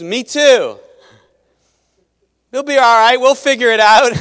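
high, loud, overly cheerful doctor reassurance; nervous doctor. Acted. Doctor reassuring the worried wife, loud high mock cheer. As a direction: make the doctor sound comically unsure. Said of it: "and he goes".

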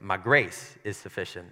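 "grace" leaned on, low pitch; heavy emphasis on "grace"; stress on grace. Shown as a demonstration. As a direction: hit the word "grace". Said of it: "or is it like".

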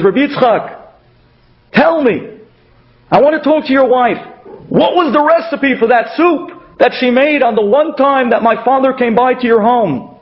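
high, eager pressing request; urgent plea. Acted. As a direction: plead urgently for an answer. Son urgently asking at the door.

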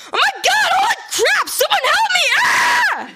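very high, loud panicked screaming; boy shrieking for help in the monster's grip; terrified yelling. Acted. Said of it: "James yelled".

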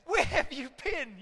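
high, slow frantic cry of a parent; a panicked parent finally finding the child. Retold or imitated. Parents finding the lost boy, frantic and high. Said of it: "I can imagine them going".

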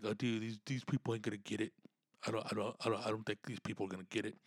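quick, hushed self-doubt, under the breath; nervous inner voice. Retold or imitated. Comic doubting himself before the crowd, fast and hushed. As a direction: rattle it off softly, like worried private thoughts. Comic imagines his own worried thoughts.

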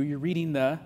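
ordinary delivery

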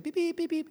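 high mock car alarm beeps; car beeping. Pastor imitating his car's seatbelt beep, high beeps. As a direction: chirp it like a seatbelt warning.

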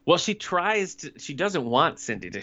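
ordinary delivery

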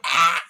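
short, loud mock yelp; goblin's cry. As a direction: yelp as if hit in the face. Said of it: "He's like".

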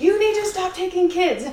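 put-on high, loud English accent, scolding. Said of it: "all of this is in an English accent".

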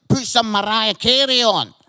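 loud, broad Scottish request to Siri; Scottish accent. Shown as a demonstration. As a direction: bark it at the phone in broad Scottish. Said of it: "being Scottish and trying to talk to Siri".

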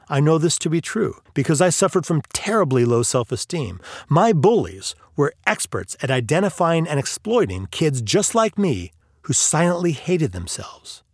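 ordinary delivery

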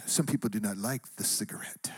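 put-on hushed, low Syrian-accented whisper; soft, deep put-on Syrian accent; Syrian woman's accent. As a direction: imitate a Syrian woman confiding, almost whispered. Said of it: "And she goes".

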